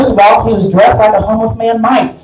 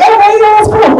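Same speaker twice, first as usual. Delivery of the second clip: high mock-casual; 12 semitones higher, 4 dB louder than usual. Comic quoting herself to homeless man.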